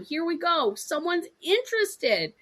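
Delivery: imitating giddy excitement at a hot lead; excited. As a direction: savor the catch. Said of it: "And you're excited".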